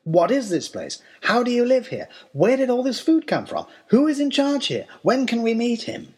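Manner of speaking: rising, pressing questions one after another; eager barrage of questions. A narrator's reading. Explorers bombarding the host with questions, pressing and rising. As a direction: pepper him with questions. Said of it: "started bombarding him with questions".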